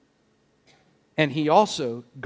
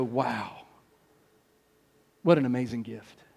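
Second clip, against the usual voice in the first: low, much softer wonder; hushed awe; 6 semitones lower, 9 dB quieter than usual. Acted. Believer walking around amazed at the gift, hushed awe. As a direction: drop right down into humble amazement. Acts out humble awe at grace.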